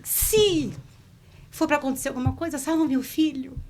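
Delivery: put-on pleading to save the baby, voice charged with emotion; tearful prayer. Mother praying for her unborn son, urgent tearful plea. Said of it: "eu falei que eu me emociono".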